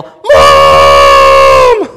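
low loud yelled Mom; imitating a toddler shouting from her room; child yelling. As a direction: toddler calling for mom from bed. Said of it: "Emma starts to yell".

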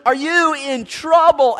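called high, loud and slow; loud slow rhetorical question. Acted. Preacher voicing James to the church, loud and slow. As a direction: hold each word out to the crowd. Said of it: "James is asking a rhetorical question".

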